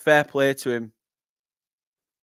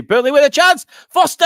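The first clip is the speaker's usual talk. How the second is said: high, loud burst on the chance; excited commentary shout; 13 semitones higher, 11 dB louder than usual. Real, not acted. Commentator sees Burnley with a chance, excited high shout. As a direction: shout the striker's name as he shoots.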